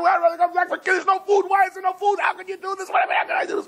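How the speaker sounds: very high, hammed-up shouting match; frantic quarrel. Husband and wife fighting over no milk, frantic high yelling. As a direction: argue hysterically at the top of your range.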